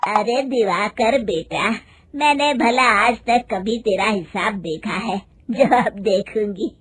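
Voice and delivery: a touch of trusting elder; lightly voiced mother-in-law. Mother-in-law waving off the accounts, mildly dismissive. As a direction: lightly play an unconcerned matriarch.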